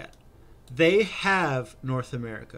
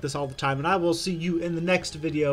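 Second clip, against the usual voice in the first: youtube outro; 4 semitones higher, 4 dB louder, faster than usual. Acted.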